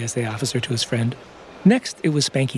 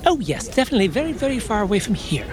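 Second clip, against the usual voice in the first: professor voice; 8 semitones higher than usual. Acted. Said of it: "said the professor".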